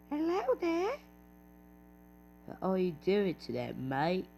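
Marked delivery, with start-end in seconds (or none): 0.1-0.9 much higher, squeaky hello; mock high greeting. Gamer greets a teammate in a silly high voice. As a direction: pop up into a silly falsetto greeting.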